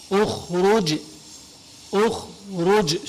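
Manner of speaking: اخرج begun with u, very slow on the second take; initial u. Shown as a demonstration. Teacher slowly demonstrates the u opening. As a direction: draw out اخرج starting with u. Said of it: "بتضمها، فبتقول اخرج".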